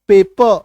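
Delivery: पेपर said like "pay-puh"; actual pronunciation, silent R. Shown as a demonstration. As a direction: drop the final R of पेपर. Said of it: "actual उच्चारण".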